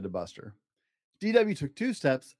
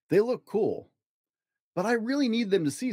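put-on higher childlike voices for the siblings; lightly voiced kids; 8 semitones higher than usual. Dad voicing kids to his listener, higher childlike voices. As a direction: play the children a touch brighter.

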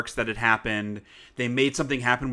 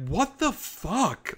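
higher, slow, incredulous "what the"; higher, slow, stunned disbelief; 9 semitones higher, 3 dB quieter, much slower than usual. Retold or imitated. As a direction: act baffled outrage, drawn out. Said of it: "I go online and I'm like".

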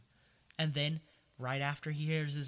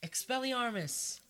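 mock spell cast; 9 semitones higher, slower than usual. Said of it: "Gilderoy Lockhart's like".

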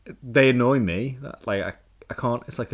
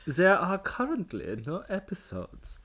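mock annoyed German reading; annoyed German accent; 8 semitones higher, 7 dB louder than usual. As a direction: read it like an irritated German. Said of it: "Can you read out as a German? An annoyed German".

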